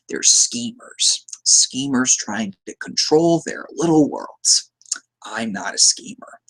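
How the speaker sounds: slow, hushed, breathy Joker voice; Joker impression. Fan quoting the Joker in a slow, hushed voice.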